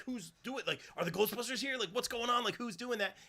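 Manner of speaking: high, puzzled excited questions; baffled by what's happening; confused surprise. Retold or imitated. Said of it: "You're like".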